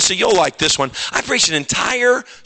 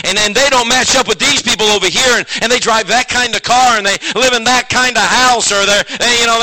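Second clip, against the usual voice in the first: usual speaking voice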